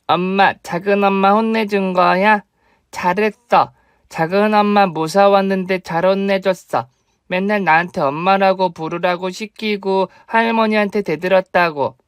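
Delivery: little son. Acted. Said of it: "제 아들이 문을 열고 나왔습니다".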